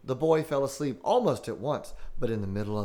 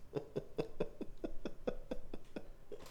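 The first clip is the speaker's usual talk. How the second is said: laughing; 12 dB quieter than usual. Real, not acted. After the scare.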